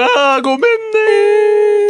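high, drawn-out mock-pleading sorry; hammed-up apologetic cry; apologetic wail. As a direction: stretch out an exaggerated apology to a baby. Acted apology to crying baby.

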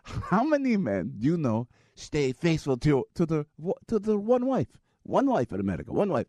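halting mock Pakistani accent. Driver challenging passenger on faithfulness, in a mock Pakistani accent. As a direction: argue it in a faked Pakistani accent.

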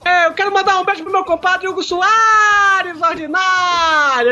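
mock shoutout.

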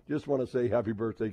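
normal talking voice

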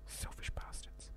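muttered under the breath, slow; mock bitter whisper; whispered insult. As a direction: hiss it as a playful jab. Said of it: "mock insult whispered".

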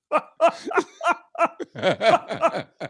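high, badly faked staccato laugh; Struddy laugh impression. Shown as a demonstration. Co-host doing his best at the impression, forced high laugh. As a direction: attempt a friend's big repetitive laugh. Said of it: "Okay, I'll do my best here".

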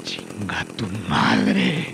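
slow, low, spat-out curse; slow defiant curse. Acted. Prisoner curses the boss.

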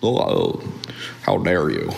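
breathy oh, then a deep mocking how dare you; mock the offended person; a hammed-up scandalized gasp; mock indignation. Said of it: "They're like".

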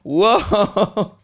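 loud, high, drawn-out whoa; mock stunned hollering; imitated crowd reaction. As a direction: hoot like friends reacting to a burn. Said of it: "all her friends are just like".

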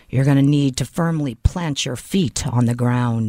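very low monotone officer voice; imitating a stern agent, low and monotone; deadpan agent. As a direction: drop deep and deliver it level and deliberate. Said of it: "she says to me just like this".

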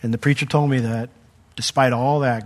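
usual speaking voice

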